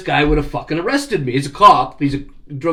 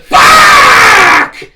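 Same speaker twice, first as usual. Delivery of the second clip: very high, very loud yelled curse; all-out yelled swear; furious shout; 15 dB louder than usual. Retold or imitated. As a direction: scream it at the top of your lungs. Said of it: "I'm like".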